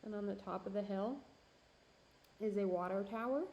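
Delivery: ordinary talk, nothing marked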